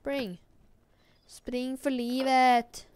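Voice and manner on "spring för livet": mock urgent. Urging character to run.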